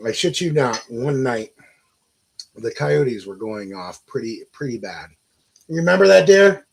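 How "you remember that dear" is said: called out louder and higher across the house; calling out. Real, not acted. Asking his wife from the next room, calling out loud.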